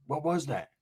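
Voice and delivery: softly voiced wary question; hushed alarm. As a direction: ask it under your breath. Same hushed reaction retold.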